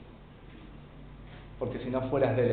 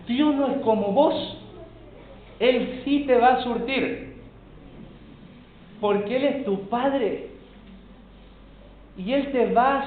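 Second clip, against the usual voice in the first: high-pitched, emphatic sermon delivery; raised preacher's voice with pauses between phrases; insistent preaching; 7 semitones higher than usual. Real, not acted. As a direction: press each phrase home from the pulpit.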